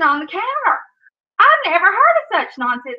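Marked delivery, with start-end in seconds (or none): 1.4-3.0 higher, louder outrage; indignant. Real, not acted. Vlogger indignant at a troll's comment, raised outrage. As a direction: scoff at the nonsense.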